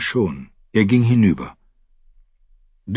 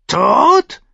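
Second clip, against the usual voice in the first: high, squawking bird croak; croaking raven; 6 dB louder than usual. Acted. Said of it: "krächzte der Rabe".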